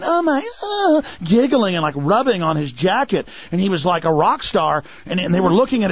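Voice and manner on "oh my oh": much higher, slow breathy-sounding swoon imitated; mock a fawning fan swooning over him; gushing supermodel. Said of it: "beautiful women were walking up going".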